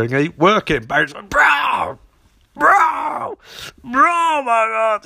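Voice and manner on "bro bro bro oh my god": high, hammed-up yowl; mock agony. Barry jolted by the defibrillator, yowling in mock pain. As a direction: howl each cry as if being zapped.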